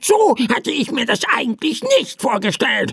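high, quick, annoyed croak; verärgert krächzender Vogel. A narrator's reading. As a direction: squawk it like a peeved crow. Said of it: "krächzte der Vogel verärgert". (verärgertes, hohes Krächzen)

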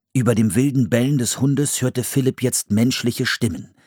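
usual speaking voice

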